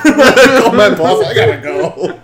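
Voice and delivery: laughing high excuse to get away; flustered escape. Retold or imitated. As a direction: laugh while making an excuse to leave. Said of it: "Drew, I gotta go".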